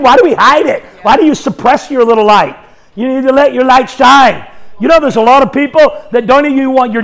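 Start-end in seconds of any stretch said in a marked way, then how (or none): none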